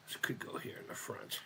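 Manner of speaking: whispered repeat of the same line. Shown as a demonstration. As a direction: breathe it out with no voice. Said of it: "repeat in a whisper".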